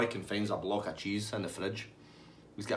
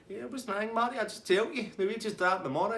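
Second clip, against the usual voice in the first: high pleading Davey; 11 semitones higher than usual. Retold or imitated.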